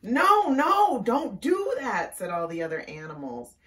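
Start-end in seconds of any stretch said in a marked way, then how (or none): 0.0-2.2 high, loud pleading; alarmed warning. Acted. Animals warning Mama Frog, loud alarmed plea. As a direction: cry out to stop her.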